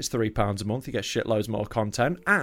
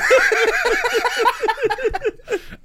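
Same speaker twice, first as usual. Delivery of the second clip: shrieking burst of laughter; loud high laugh; 4 dB louder than usual. Real, not acted. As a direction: cackle with delight. Laughing at drag name joke.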